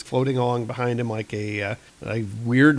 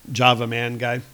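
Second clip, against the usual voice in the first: ordinary talk, nothing marked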